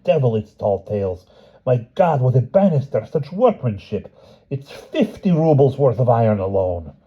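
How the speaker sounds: simple village blacksmith in awe; amazed blacksmith. Acted. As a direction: gawk at the rich banister. Blacksmith marvelling in palace.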